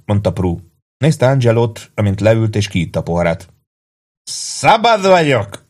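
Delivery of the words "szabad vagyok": put-on high, slow drunken roar; slow, high drunk shout; drunken yell. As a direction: bellow it out like a free man. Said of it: "ordította Angelo".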